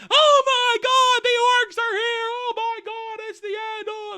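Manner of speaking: very high falsetto shriek, loud then fading; mock panic. Everybody going wild as the orcs arrive, mock shrieking. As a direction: scream in comic terror, voice squealing away. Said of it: "while everybody's going".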